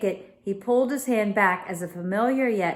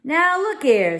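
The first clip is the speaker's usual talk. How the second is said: sharp, raised protest; 9 semitones higher, 7 dB louder than usual. Acted. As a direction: snap at them to stop. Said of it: "said Crowley sharply".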